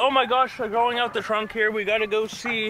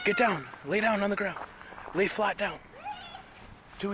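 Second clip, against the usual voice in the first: ordinary talk, nothing marked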